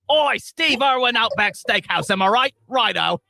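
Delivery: hammed-up fake Australian; bad Australian accent. Australian rider chatting after the ride, hammed-up bad accent. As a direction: ham up a matey Australian, badly faked. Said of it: "the Australian dude won't shut".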